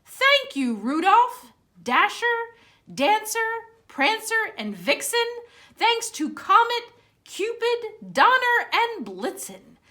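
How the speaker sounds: high, loud, cheery Santa calling out; jolly exclaim. Acted. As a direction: call it out like Santa waving from his sleigh. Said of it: "you could hear him exclaim".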